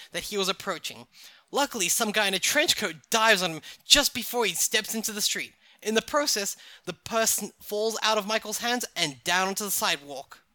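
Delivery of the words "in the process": plain reading voice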